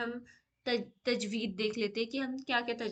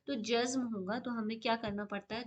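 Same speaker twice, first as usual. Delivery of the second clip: ordinary delivery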